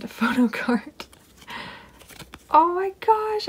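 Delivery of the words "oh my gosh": high thrilled oh my gosh; excited gasp. Real, not acted. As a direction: gush with sudden delight. Finds the photo card she wanted.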